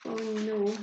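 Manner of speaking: ordinary delivery, nothing marked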